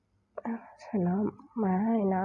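slow, soft whisper to the buffalo; quiet whisper. Retold or imitated. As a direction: breathe the words out slowly and gently. พูดกับควายตัวแรกเบาๆ. (กระซิบช้าๆ เบาๆ กับควาย)

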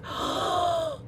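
sharp intake of breath in admiration; awed gasp. Pretend. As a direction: gasp at something gorgeous. Reveals a napkin she loves.

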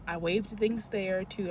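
normal talking voice

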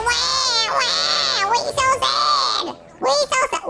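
mocking the losers with a fake wail; mock crybaby wail. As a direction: mimic babies crying to mock them. Mocking crying of other players.

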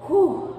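loud high whew after effort; relieved exhale. Real, not acted. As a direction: blow out hard after the last rep. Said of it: "my abs are burning".

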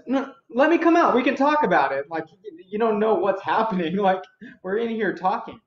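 loud, high acted pleading; high-pitched protest. Lancelot protests to knights outside the door, high loud pleading.

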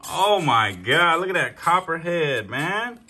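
loud, high and stunned; drawn-out awe at the kill; amazed. Real, not acted.

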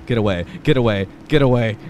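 ordinary talk

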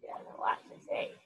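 quick unvoiced aside; whisper. Real, not acted. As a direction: lean in and whisper it fast. Aside to listeners.

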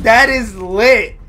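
ordinary talk

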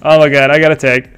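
normal talking voice

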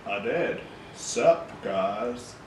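too-cool slangy greeting; lazy, cocky teen swagger; cool kid attitude. Acted. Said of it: "Why are you talking like that?".

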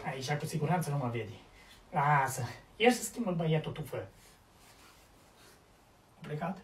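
very soft, low voice; hushed while hiding. Acted. Husband hiding from wife's call, hushed low voice. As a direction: keep it low so nobody finds you. Hiding from his wife, to himself.

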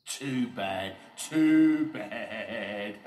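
loud mocking laugh through the words; scornful, drawn-out cackling taunt; Oliver Overdrive, laughing. A narrator's reading. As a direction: jeer and dissolve into laughter. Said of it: "laughed Oliver Overdrive".

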